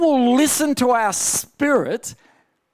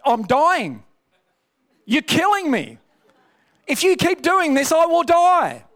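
higher, rising to a louder dramatic whine; complaining body; 7 semitones higher, 3 dB louder than usual. Retold or imitated. His body protesting after days without food, higher mounting dramatic whine. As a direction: whine as if the fast is killing you. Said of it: "started to say things like this".

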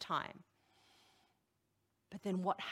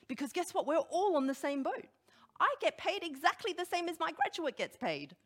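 excited emphasis; 9 semitones higher, 5 dB louder than usual. Real, not acted. Preacher building to emphatic point.

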